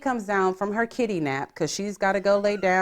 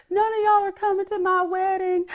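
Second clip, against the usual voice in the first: much higher mock-whiny voice; mocking sulky sister; 11 semitones higher than usual. Sister mocking Amy's sulky wedding threat, whiny put-on voice. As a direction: pout it out like a sulking child. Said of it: "She goes".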